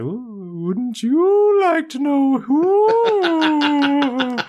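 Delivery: high, wailing mock ghost, vowels drawn way out; unintimidating ghost voice. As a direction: moan it like a silly spook. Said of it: "That voice isn't very intimidating".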